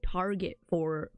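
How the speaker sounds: ordinary talk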